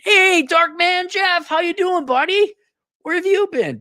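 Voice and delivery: loud, high, drawn-out hello; excited greeting. Real, not acted. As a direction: belt out a welcome to a returning regular. Hearty greeting to a returning viewer.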